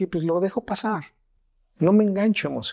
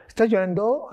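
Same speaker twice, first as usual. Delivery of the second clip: higher, rising mock astonishment; incredulous question; 7 semitones higher than usual. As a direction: ask it high, as if you can't believe it. Mimics an unwelcome reaction to tears.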